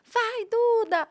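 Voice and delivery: calling out loud and high like a crowd cheering; retold cheering shout. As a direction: cheer her name from the audience. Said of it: "falavam assim". (grito de torcida, alto e agudo)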